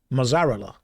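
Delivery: "Mozzarella" as muh-ZARE-uh-la; mock mispronunciation. Shown as a demonstration. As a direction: mangle "Mozzarella" deliberately. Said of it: "How do you say mozzarella".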